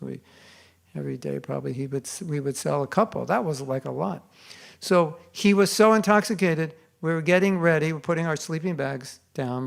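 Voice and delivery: ordinary talk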